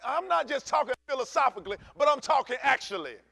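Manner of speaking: loud, high preaching. Acted. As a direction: drive each line home like a preacher. Building sermon passage.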